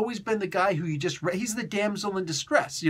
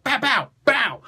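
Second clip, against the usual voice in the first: slow, punched mouth gunshots; gunshot sounds; 6 semitones higher, 5 dB louder, slower than usual. Retold or imitated. Fan imitating a mob hit, slow mouth gunshots. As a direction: spit out three mock shots. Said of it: "drive-by mob hit and just straight up".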